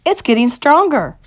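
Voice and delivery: excited witch on the scent; urgent insistence. Acted. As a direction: press them with growing urgency. Mildred insists on the smell.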